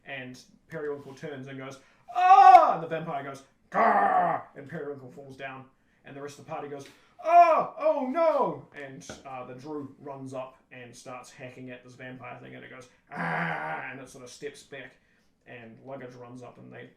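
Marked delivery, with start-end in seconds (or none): 2.1-2.8 very loud, high stage scream; mock scream. Periwinkle turns and sees the vampire, hammed-up scream. 3.7-4.4 loud, raised hammy monster roar; vampire snarl. Retold or imitated. Vampire attacks Periwinkle on stage, mock monster snarl. 7.2-8.6 loud, high hammy cry of dismay; mock horror. Party watches Periwinkle fall, hammed-up horror. 13.1-13.9 loud mock cry of pain. Vampire steps back from the hacking, mock pained cry.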